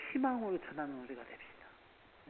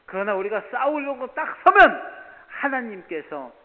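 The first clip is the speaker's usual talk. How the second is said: loud, high impassioned preaching; full-force preaching; 7 semitones higher, 18 dB louder than usual. Acted. Pastor preaching loudly to the congregation about David.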